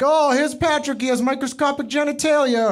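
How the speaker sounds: high, loud mocking taunt; high playground insult; taunting kid voice. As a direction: jeer it like a schoolyard bully. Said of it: "And they'd be like".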